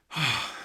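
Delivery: short, relaxed audible sigh; settling sigh. Real, not acted. Host settling in for meditation, settling sigh. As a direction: let out a sigh as you settle.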